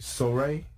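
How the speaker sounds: "Soiree" said as "so-RAY", questioning; tentative pronunciation. Shown as a demonstration. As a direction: try "Soiree" as "so-ray", checking it is right. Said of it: "make sure I say it right".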